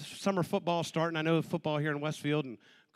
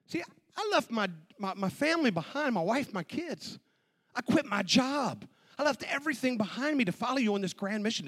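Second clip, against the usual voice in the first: protesting disciple; 6 semitones higher, 3 dB louder than usual. Acted.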